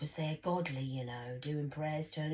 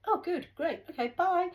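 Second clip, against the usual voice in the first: high, loud, quick goodbye; breezy brush-off; 6 dB louder than usual. Retold or imitated. Retelling the police's goodbye, breezy brush-off. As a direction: toss off a high, dismissive farewell. Retold brush-off after refusing help.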